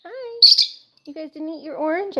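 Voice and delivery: high cooing voice for little birds; pet talk. Real, not acted. Owner greeting her lovebirds in a high cooing voice.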